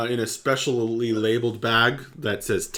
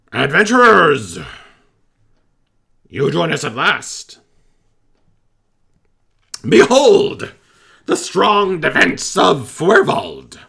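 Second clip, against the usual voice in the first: high, loud, proud goblin voice; goblin captain; 8 semitones higher, 8 dB louder than usual. Acted. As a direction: bellow it like a pompous little goblin captain.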